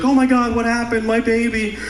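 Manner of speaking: high-pitched imitation of a panicked mother; mock panicking mom. Mom seeing her son's broken arm, mock panic. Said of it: "she starts panicking".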